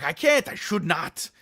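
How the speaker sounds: high, loud hammed-up French accent; emphatic protest in a put-on French accent; mock French accent. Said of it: "Your French accent is very g".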